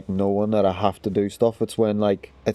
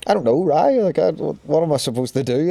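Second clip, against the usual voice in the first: higher-pitched, flustered protest; helpless, pitched-up retort; retold fluster; 6 semitones higher than usual. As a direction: plead mild bewilderment, retelling it. Retold flustered reply to pressure.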